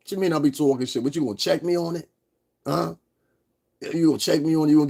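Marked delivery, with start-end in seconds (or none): none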